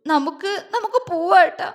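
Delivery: high, tearful acted plea; sobbing. A narrator's reading. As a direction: plead through small sobs. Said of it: "അവൾ തേങ്ങിക്കൊണ്ട് പറഞ്ഞു".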